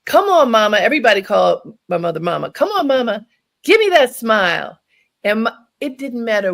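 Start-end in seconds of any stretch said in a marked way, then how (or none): 0.0-0.8 higher, louder coaxing call; rally her like a lively nurse; energetic aide. Retold or imitated. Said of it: "She had a lot of energy". 2.5-4.8 higher, louder coaxing call; rally her like a lively nurse; energetic aide. Retold or imitated. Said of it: "She had a lot of energy".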